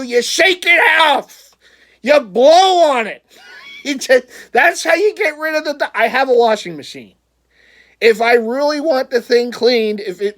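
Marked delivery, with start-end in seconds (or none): 0.0-5.9 exasperated rant. Real, not acted. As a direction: spell it out like it's obvious.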